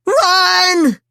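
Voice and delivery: high, loud yell to run; frantic shout. A narrator's reading. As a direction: scream it in panic. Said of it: "Michelangelo shouted".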